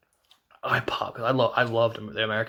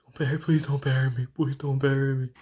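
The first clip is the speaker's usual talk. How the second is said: mock crying plea; 2 semitones higher than usual. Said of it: "A J's like crying".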